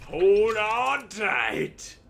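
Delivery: slow, drawn-out loud cry of warning; dramatic shout. Acted. Game master shouting to the player mid time-travel, drawn-out loud cry. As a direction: yell it as the ride takes off. GM narrating wild time ride.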